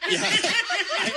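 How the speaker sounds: bursts out laughing mid-word. Real, not acted. Laughing at his own pager story.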